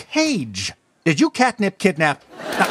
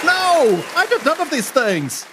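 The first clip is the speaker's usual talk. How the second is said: indignant denial; 9 semitones higher than usual. Acted. Denying the kidnapping accusation.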